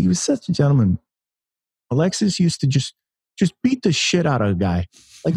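a playful, hammed-up imitation of a famous boxer; Mike Tyson impression. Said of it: "that's my terrible Mike Tyson impression".

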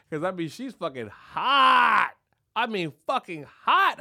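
loud and slow, leaning hard on every word; excited outburst. Real, not acted. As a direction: shout it out like you can't believe your luck. Raving about a hot woman.

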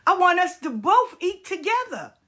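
high, pleading retelling; Will pleading with his friend; earnest Will. Retold or imitated. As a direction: plead to share his success. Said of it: "He said".

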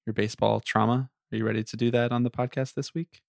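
soft, gentle counselor tone; therapist voice. Pretend. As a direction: speak like a calm therapist. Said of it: "therapist voice the entire time".

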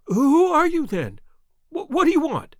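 high, loud, frightened stammer; startled and trembling, pitch jumping up; quivering voice. A narrator's reading. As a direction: quiver with alarm, tripping on the words. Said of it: "he asked in a quivering voice".

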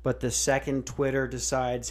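usual speaking voice

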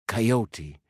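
ordinary delivery, nothing marked

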